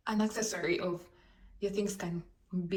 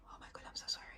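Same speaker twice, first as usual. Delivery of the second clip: hushed, embarrassed apology; mortified whisper; 10 dB quieter, much faster than usual. Retold or imitated. To the stranger whose door she opened, a mortified whisper. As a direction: whisper the apology, backing away.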